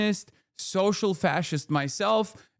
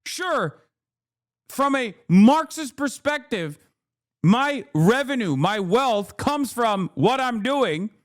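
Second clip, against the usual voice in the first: heated rant; 9 semitones higher than usual. Real, not acted. Still ranting at chat.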